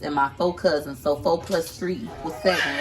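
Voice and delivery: ordinary talk, nothing marked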